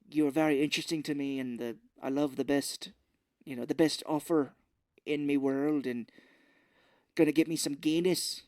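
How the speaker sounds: mock Irish brogue, a bit fast; hammed-up movie Irish voice; bad Irish accent. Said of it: "terrible Irish accent".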